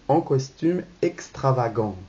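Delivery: normal talking voice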